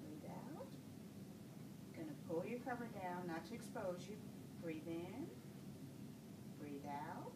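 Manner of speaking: low, soft, unhurried breathing cues; steady bedside voice for deep breaths; calm breathing coaching. Acted. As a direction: guide each breath in and out gently. Nurse coaches patient's deep breathing.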